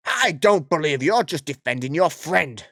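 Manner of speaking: hammed-up outraged customer; Karen voice. Karen threatening to report the store, mock outraged. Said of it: "I can't keep this voice up".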